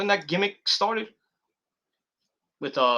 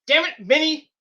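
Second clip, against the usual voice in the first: blurted outburst; 7 semitones higher than usual. Retold or imitated. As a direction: burst out in mock anger. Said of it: "just blurts out".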